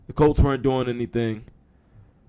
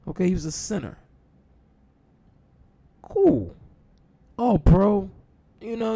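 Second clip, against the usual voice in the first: incredulous; 9 semitones higher than usual. Real, not acted. Incredulous rant about hire.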